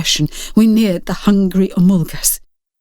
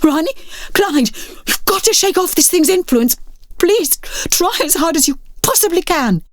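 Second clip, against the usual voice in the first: desperate pleading; 9 semitones higher than usual. Acted. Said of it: "the mounting desperation out of my voice".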